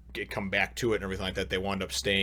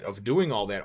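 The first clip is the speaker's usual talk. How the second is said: ordinary delivery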